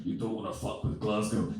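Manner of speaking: low, softly spoken Glaswegian threat; imitated Glaswegian tough talk. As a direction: warn them off in a mock Glaswegian accent. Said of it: "heard a Glaswegian".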